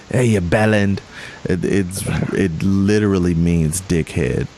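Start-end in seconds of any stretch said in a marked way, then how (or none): 0.1-1.0 tossing off a British insult; mock British insult. Shown as a demonstration. Demoing slang to his co-host in a light British accent. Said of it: "you can call someone".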